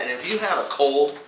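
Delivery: normal talking voice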